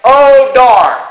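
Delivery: a high, loud, hammed-up "Oh darn"; mock the polite minced oath; mock prim exclamation. Mocking people who say it, a high hammed-up "Oh darn". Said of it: "Don't say".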